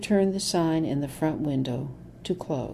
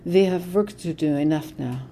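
put-on heavy German accent, steadying herself; German-accented grandmother pulling herself together; strong German accent. A narrator's reading. Grandmother to Gretchen after crying, thick German accent. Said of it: "her accent even more pronounced than usual".

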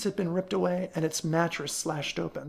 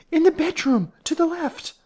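high, loud urgent cry; screaming; 12 semitones higher, 6 dB louder than usual. A narrator's reading. Miss Curley directing Ellery after the intruder, screaming. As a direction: shout directions in a panic. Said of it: "screamed Miss Curley".

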